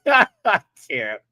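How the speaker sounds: ordinary delivery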